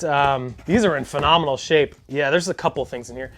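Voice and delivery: ordinary delivery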